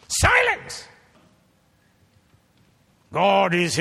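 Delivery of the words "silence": sharp high cry of Silence; shouted command. Acted. Preacher roars at the congregation to be silent. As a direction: bark it like a command from the pulpit.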